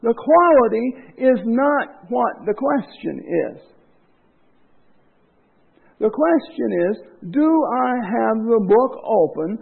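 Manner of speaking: loud, emphatic sermon delivery; full-force preaching. Acted. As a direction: press each point hard on the church. Preaching on singing with heart.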